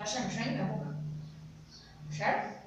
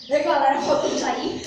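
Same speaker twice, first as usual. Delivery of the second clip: vlog host; 4 semitones higher, 10 dB louder than usual. Real, not acted. Host addresses viewers.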